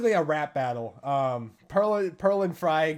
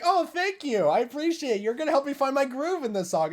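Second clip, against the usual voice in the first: high, gushing thanks; Marina, flattered; 9 semitones higher than usual. Retold or imitated. Imitating singer thanking her rival, flattered. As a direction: take it as a compliment, pitched up. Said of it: "starts taking it as a compliment".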